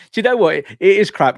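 emphatic loud agreement. Real, not acted. Host loudly agreeing with quote.

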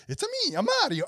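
high fake Italian accent as Mario; hammed-up Italian plumber accent. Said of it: "this fake Italian accent".

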